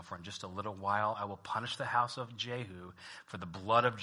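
soft, measured Bible reading; reading Hosea to the congregation; scripture reading. Acted. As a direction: read the text quietly and steadily. Reads Hosea chapter one aloud.

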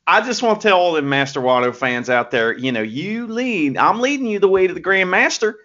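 put-on Southern accent, lightly done; a hint of a put-on American South accent; Master Wato Southern accent. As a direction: play a folksy Southern good-guy wrestler. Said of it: "Southerners with that kind of accent".

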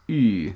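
"Ü" like ee with lips pushed round; rounded ü vowel. Shown as a demonstration. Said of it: "it's just like E, but you round your lips".

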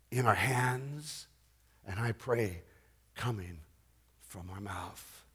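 low, very soft preaching with long pauses; hushed solemn declaration. Real, not acted. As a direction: let each phrase sink in, fading almost to nothing.

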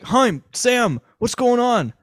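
hammed-up panicked cry to his executives; freaking out. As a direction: imitate a frantic boss calling for help. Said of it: "freaking out".

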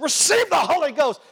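high, loud fervent proclaiming; preacher laying a hand on the forehead, loud high proclamation; preacher's proclamation. Retold or imitated. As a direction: cry it out like a revival preacher.

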